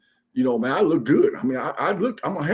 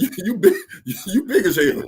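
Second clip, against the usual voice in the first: laughing; 7 semitones higher than usual. Retold or imitated. Retold reply, laughing.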